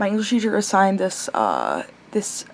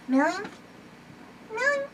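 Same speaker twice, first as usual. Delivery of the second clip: very high and soft pet call; high, hushed coo at the cat; 14 semitones higher, 10 dB quieter than usual. Real, not acted. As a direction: coo the name gently. Cooing name at the pet.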